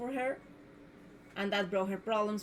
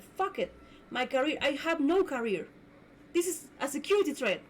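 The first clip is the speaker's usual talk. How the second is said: retold outburst; 5 semitones higher than usual. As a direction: let it burst out, fed up. Retelling her own outburst.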